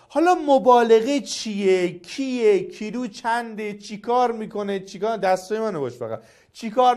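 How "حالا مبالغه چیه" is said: ordinary talk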